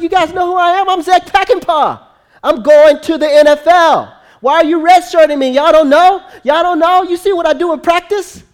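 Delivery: loud, very high indignant shouting; outraged boasting. Acted. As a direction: holler like an affronted star demanding recognition. Player ranting at being redshirted.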